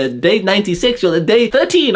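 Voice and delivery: high, mocking imitation of nofap YouTubers. Mocking nofap YouTubers' timeline, high mock voice. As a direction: parody their timeline claims in a high voice.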